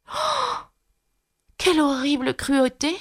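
très aiguë, plus douce, choquée; s'exclamer d'horreur en fillette; Alice horrifiée. A narrator's reading. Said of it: "s'exclama Alice". (Alice s'exclame, horrifiée, voix très aiguë)

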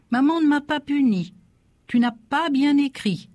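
put-on high-pitched little boy's voice; protesting child. A narrator's reading. Small boy correcting his teacher father, high protest.

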